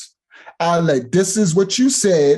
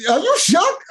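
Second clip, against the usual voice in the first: mock surprise. Real, not acted. Mocking the rival pastor.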